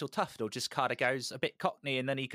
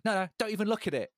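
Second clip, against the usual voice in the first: quick, high-pitched protest; mock frantic warning; 10 semitones higher, faster than usual. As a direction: hurriedly warn them off, imitating a precious collector. Acts out guitarist guarding his guitar.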